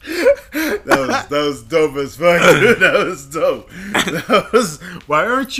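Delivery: loud, high burst of laughter through the words; laughing hard. Real, not acted. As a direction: crack up at the joke. Cracking up at friend's voice.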